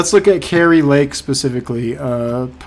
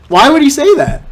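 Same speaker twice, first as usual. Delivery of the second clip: higher, louder disbelieving repeat; incredulous; 12 semitones higher, 7 dB louder than usual. Real, not acted. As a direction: say it again, now baffled and loud. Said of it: "repeated disbelief".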